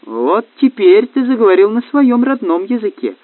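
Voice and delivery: high, mocking approval; triumphant needling; taunting. A narrator's reading. Woman taunts the raging boss.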